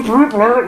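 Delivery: high, loud mock tuba blurts; clumsy brass noises made with the voice; imitated bad tuba. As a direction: blurt out a badly played band horn. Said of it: "it's that shit sound of".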